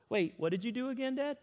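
put-on a boy who has no idea; puzzled son. Son stops playing to ask his dad, puzzled kid. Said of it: "and he goes".

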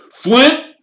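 loud, high shouted name; angry, high-pitched hail; bellow. A narrator's reading. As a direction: bellow at a rival captain. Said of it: "Wood bellowed at the Slytherin captain".

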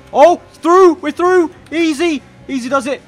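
high burst, loud then easing off; excited relief. Real, not acted. Gamer getting through the first corner, excited relief. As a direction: celebrate a gap opening up, high-pitched. Gets through the start cleanly.

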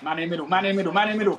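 urgent teammate call. Real, not acted. Calling instructions to teammates mid-match.

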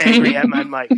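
a laugh bursting through. Real, not acted. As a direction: laugh out the last words. Laughs finishing the clarification.

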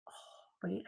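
usual speaking voice

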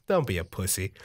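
low, understated dad voice; mock gruff dad. Dad behind hesitant son on a sled, low goading. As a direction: drop the pitch like an unimpressed father.

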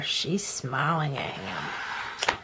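soft, slow and low; tender aside. Real, not acted.

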